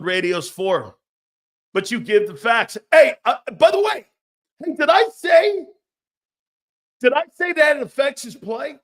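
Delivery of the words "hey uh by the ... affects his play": high, loud indignant challenge; heated shout. Real, not acted. Host firing back at Philly callers, loud and high. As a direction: yell it back at the critics.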